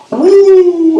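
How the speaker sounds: high loud whoop; mock high whoop. Hunter imitating a strange call from the woods. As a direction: let out a piercing woo.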